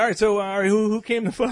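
imitating his own hyped emcee voice; emcee hype. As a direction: work the crowd, fishing for a game. Retold MC improvising to crowd.

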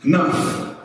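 sharp high command to the storm, each word alone. Acted. Preacher voicing Jesus silencing the storm, commanding. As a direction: order the storm to hush with full authority. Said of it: "to say to whatever that storm is".

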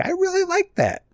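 very high, gushy imitation; mock delighted approval. Host voices cohost's unlikely reaction, mock delight in high voice. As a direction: squeal out pretend approval lightly.

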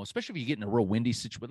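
normal talking voice

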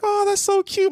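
high, sweet cooing. Retold or imitated. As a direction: coo it like a crowd of passengers. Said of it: "all the people on the plane".

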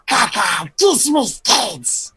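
slow, lightly voiced little gremlin. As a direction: draw it out like a tiny creature. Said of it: "you're gonna have a voice for this one".

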